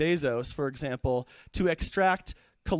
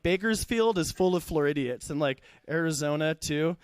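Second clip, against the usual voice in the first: ordinary talk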